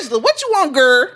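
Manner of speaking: high-pitched reenacted phone pickup; bestie answering phone. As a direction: answer your friend's call, high and sassy. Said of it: "let's reenact it".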